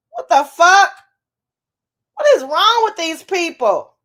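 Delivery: loud, high outraged rant; escalating comedy rant. Real, not acted. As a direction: build into loud disbelief. Outraged rant about the guest's family.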